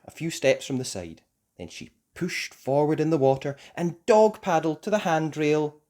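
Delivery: plain reading voice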